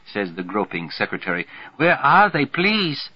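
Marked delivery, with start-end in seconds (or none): 1.8-3.1 put-on high, pleading German accent. A narrator's reading. Groping secretary asking for her glasses, German accent. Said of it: "German accent, all right".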